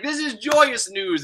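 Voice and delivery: high, slow and overjoyed; cheery king. Retold or imitated. The king greeting the marriage proposal, high, slow and beaming. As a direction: beam it out slowly like a clueless king. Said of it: "he's like".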